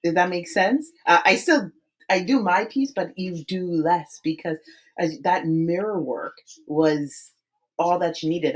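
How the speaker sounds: ordinary talk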